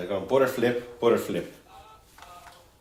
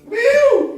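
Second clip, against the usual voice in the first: very high, loud "woo"; high celebratory whoop; excited whoop; 10 dB louder than usual. Real, not acted. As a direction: let out a piercing cheer. Excited cheer for the sandwich.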